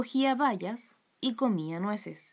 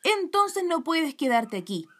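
angry mother, high and raised voice; grito muy enfadado; 7 semitones higher, 4 dB louder than usual. A narrator's reading. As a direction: order the daughter out angrily, pitched up. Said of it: "gritó mamá muy enfadada". (madre muy enfadada, aguda y alzando la voz)

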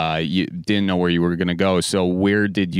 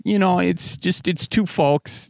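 high silly voice; 9 semitones higher than usual. Acted. Jokes about voices in his head.

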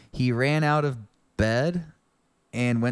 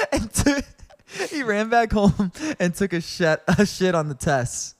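high, laughing through the words; real giggles taking over the reading; cracking up; 9 semitones higher, 3 dB louder than usual. Real, not acted. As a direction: try to read on while breaking into laughter. Reader cracking up at the line.

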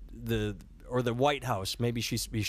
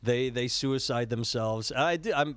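ordinary talk, nothing marked

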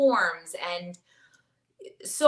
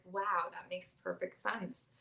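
quiet wonder; 10 dB quieter, slower than usual. Retold or imitated. Inner thought at aha moment.

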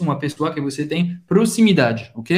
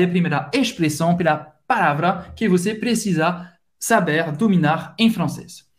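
ordinary delivery

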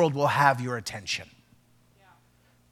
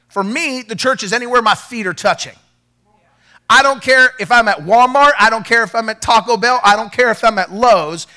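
fiery preaching; 3 semitones higher, 5 dB louder than usual. Acted. Pastor rallying the church to bring faith everywhere, fiery preaching. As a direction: preach it hard to the congregation.

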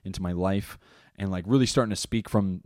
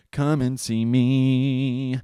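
drawn-out invitation; 4 semitones higher, 4 dB louder, much slower than usual. Real, not acted. Inviting audience to shows.

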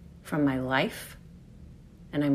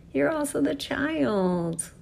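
tender reminder; 8 semitones higher, slower than usual. Acted. Gentle repeat of line 95.

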